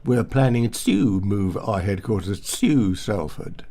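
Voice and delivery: each "to" drawn out as "tsyoo"; even worse "to". Shown as a demonstration. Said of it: "Or even worse".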